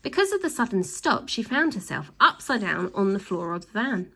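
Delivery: ordinary delivery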